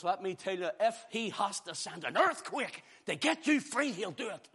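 usual speaking voice

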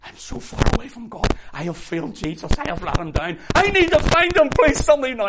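loud, high anguished outburst; desperate cry. Retold or imitated. Peter confessing he has failed Jesus, desperate shouted plea. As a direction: plead loudly, climbing to a yell.

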